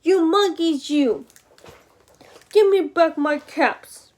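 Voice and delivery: loud story-time shout, falling at the end; angry shout. A narrator's reading. Peddler stamping both feet at monkeys, angry shout. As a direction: shout it in exasperation. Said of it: "He stamped both of his feet and shouted".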